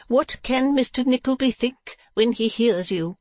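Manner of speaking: high-pitched wifely warning; Madame Mantalini. Acted. Wife warning her husband about his talk, high voice. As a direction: warn in a high wife's voice.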